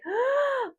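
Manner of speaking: sharp, high intake of breath; mock horrified gasp. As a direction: re-enact a gasp of dread. Retells finding the opened box.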